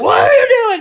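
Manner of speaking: hammed-up enraged father screaming; angry dad yell. Dad running at his runaway kids, furious shout.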